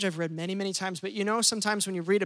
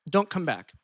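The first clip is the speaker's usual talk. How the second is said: commanding; 4 dB louder than usual. Retold or imitated. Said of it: "he commands".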